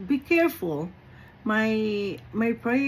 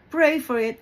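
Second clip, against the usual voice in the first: normal talking voice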